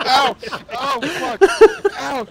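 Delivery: high, loud yelps of pain; real pain from beer up the nose. Real, not acted. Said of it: "this is so painful".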